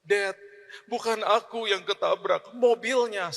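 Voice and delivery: a hint of a weeping son; imitated crying child. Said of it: "Dia langsung nangis".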